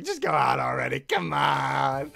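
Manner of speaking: loud, exasperated shout; shouted urging. Real, not acted. Fan shouting at the screen for them to go out. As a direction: yell it at the screen.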